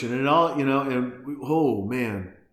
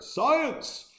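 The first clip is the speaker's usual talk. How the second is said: high, far-off booming shout of science; dramatic exclamation. Acted. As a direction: proclaim it theatrically.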